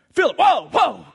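high, loud startled call; Peter calling out. Acted. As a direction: stop him mid-count. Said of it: "Peter comes up and says".

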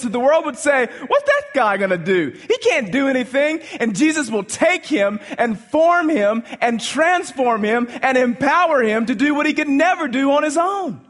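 high, loud preaching build; full-force preaching. Acted. Pastor rousing the congregation about James, in full-force preaching. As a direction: preach it out to the back pew.

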